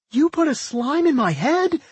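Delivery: high-pitched cry of horror; horrified scream. A narrator's reading. Millie learns Doc left a slime inside her, horrified. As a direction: shriek the question, aghast. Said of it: "Millie screamed in horror".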